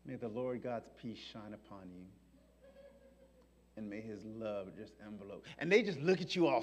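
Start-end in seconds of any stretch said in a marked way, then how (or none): 0.0-5.4 soft, serene blessing; gentle blessing. Retold or imitated. Preacher to his slanderers, a soft calm blessing. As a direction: bless them softly and calmly.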